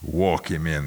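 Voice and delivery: ordinary delivery, nothing marked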